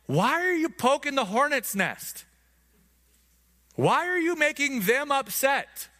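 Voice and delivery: the men of Judah nag Samson, high anxious mock whine; whiny townsmen. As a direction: play the frightened neighbours begging him to stop. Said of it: "they're like".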